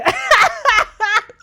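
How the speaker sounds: a loud, high burst of real laughter. Real, not acted. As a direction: crack up loudly. Laughs at her own joke.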